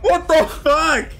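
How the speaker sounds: frustrated shout. Real, not acted. Dies in game.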